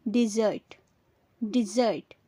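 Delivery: "डिजर्ट" as di-ZURT, short i first; weight the second syllable of "डिजर्ट": di-ZURT; correct pronunciation. Shown as a demonstration. Teacher giving learners the correct form, "डिजर्ट" as di-ZURT. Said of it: "इसकी correct pronunciation होती है".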